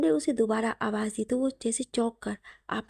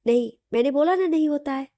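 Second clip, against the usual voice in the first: louder, higher, stubborn denial; insistent protest; 4 semitones higher, 6 dB louder than usual. A narrator's reading. Wife protesting her husband's teasing, insistent and louder. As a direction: put your foot down, half-pouting.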